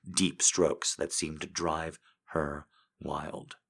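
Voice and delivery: ordinary delivery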